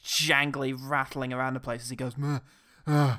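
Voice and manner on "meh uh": Homer Simpson groan. Retold or imitated. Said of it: "It was quite Homer Simpson".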